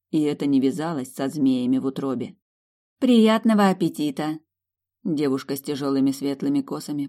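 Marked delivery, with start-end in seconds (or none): 3.0-4.4 bright, high, friendly greeting; cheerful waitress. Acted. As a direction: welcome the guest brightly.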